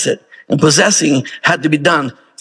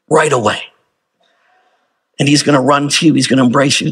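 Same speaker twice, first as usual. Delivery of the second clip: ordinary talk, nothing marked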